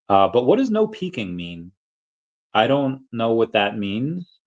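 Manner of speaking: normal talking voice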